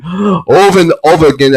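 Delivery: normal talking voice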